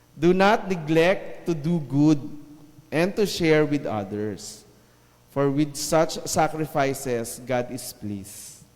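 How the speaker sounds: slow, measured Bible reading; scripture reading. Acted. Preacher reads Hebrews aloud to the church, slow and measured. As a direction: read the verse slowly to the congregation.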